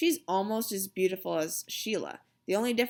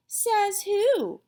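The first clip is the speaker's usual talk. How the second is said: put-on defiant girl; 12 semitones higher, 5 dB louder than usual. A narrator's reading. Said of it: "she asked".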